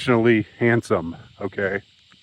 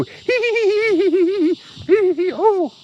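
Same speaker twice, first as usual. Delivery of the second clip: high, silly giggling hee hee; squeaky hammed-up titter; mock giggle. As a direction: giggle like a flattered guy. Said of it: "they just go".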